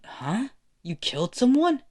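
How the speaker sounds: shocked. Acted. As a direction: react as if you can't believe it. Bakugo shocked at the news.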